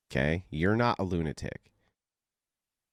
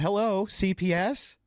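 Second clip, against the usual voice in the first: higher, questioning mock phone caller; caller to CPS; 9 semitones higher than usual. Mock-ringing child services to report a man, higher questioning voice. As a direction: play a caller phoning in, voice raised. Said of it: "I respond with".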